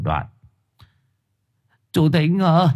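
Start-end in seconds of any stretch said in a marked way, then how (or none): 1.9-2.7 high, carrying call at a door; raised-pitch summons into the night. A narrator's reading. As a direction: call someone out of the house. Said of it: "Gọi một hồi".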